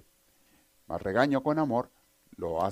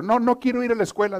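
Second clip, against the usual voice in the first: much higher, loud whiny yell; shouted refusal; 12 semitones higher, 7 dB louder than usual. Retold or imitated. Joke son shouts at his mother from his room, whiny yell. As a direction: yell it back through the door like a sulky kid. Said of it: "el hijo le grita afuera".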